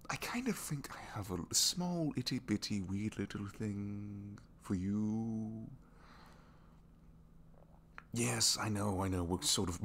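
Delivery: slow, sheepish admission, stretched out at the end; embarrassed confession. Acted. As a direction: squirm through admitting a crush. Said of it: "all right, this is embarrassing".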